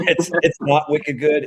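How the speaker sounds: laughing emphatic correction; emphatic denial. Shown as a demonstration. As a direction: punch the correction with a laugh behind it.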